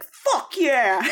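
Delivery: high, punchy shout of approval; exultant whoop; triumphant cheer. Acted. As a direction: cheer them on like a thrilled fan. Viewer cheering character singing in church.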